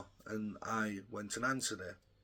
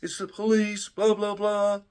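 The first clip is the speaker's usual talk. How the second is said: raised, loud voice of the police at the door; police calling out; 7 semitones higher, 6 dB louder than usual. Retold or imitated. Police outside his door calling for Josh, loud. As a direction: call through the door like an officer. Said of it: "started saying".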